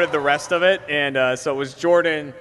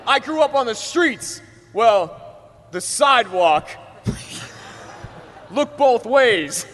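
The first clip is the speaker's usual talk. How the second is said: higher, slow, hammed-up street-tough delivery; mock tough rapper; 6 semitones higher, slower than usual. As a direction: play a wannabe gangster badly.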